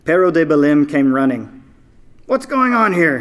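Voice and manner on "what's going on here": much higher yell. A narrator's reading. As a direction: Pero arriving at a run, yelling. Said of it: "he yelled".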